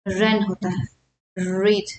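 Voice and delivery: ordinary delivery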